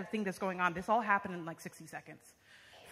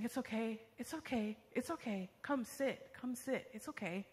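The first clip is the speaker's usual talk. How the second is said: soft, higher soothing voice; gentle reassurance; 3 semitones higher, 6 dB quieter than usual. Retold or imitated. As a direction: imitate comforting someone shaken, hushed and repeated. Strangers comforting her, voiced.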